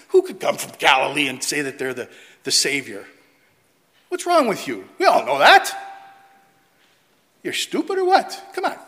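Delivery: mock them as sneering know-it-alls; scornful Pharisees. Preacher voicing scoffing Pharisees to the congregation, loud and mocking.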